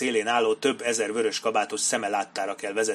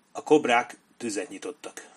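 plain reading voice

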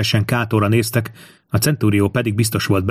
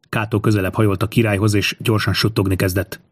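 ordinary delivery

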